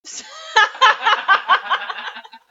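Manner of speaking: high, loud cackling laugh; loud burst of laughter. Real, not acted. Friend cracking up at a crude joke, loud high laughter.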